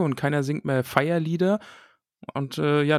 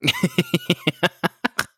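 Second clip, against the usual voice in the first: burst of laughter. Real, not acted. Laughs at a political joke. (lautes, hohes Lachen)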